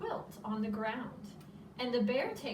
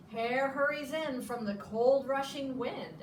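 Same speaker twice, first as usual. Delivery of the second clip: usual speaking voice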